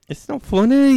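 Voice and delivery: put-on very high British little-girl voice; squeaky mock-British protest. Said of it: "laughing with the little girl".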